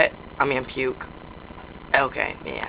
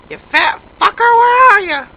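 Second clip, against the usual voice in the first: high, loud holler; loud calling out; 12 semitones higher, 8 dB louder than usual. Pretend. Player hunting for someone in the house, high loud holler. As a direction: yell it out high to someone out of sight.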